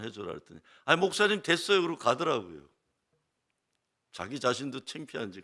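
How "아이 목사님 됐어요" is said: exasperated brush-off. Retold or imitated. Father brushing off pastor again.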